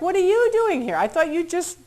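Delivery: high, loud retold astonishment; incredulous. Confronting Special Affairs at the Chicago airport, incredulous. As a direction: challenge them in high-pitched disbelief.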